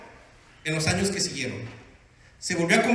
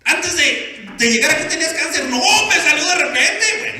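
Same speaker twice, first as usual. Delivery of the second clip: defensive protest; 6 semitones higher, 6 dB louder than usual. Acted. Husband answers pastor defensively.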